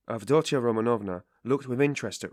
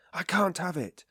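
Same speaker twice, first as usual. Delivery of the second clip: more exasperated repeat; irritable, pitched higher; 10 semitones higher, 3 dB louder than usual. A narrator's reading. Sick man refusing his mother's stay, higher irritated repeat. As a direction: snap it out a second time, higher. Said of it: "he repeated irritably".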